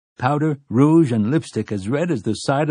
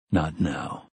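low menacing gunslinger; 6 semitones lower, 6 dB quieter than usual. Acted. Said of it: "said the gunslinger".

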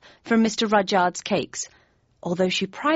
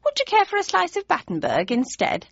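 higher, flustered sweet voice; a fluttery, higher mum; flustered mother; 10 semitones higher than usual. A narrator's reading. Mother offering cake.

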